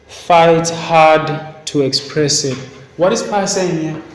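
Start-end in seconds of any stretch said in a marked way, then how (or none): 0.1-2.6 slow, weighty "fight hard to express it"; slow emphasis. Shown as a demonstration. Teacher stresses Pi's advice for the class, slowly and deliberately. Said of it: "He says you must".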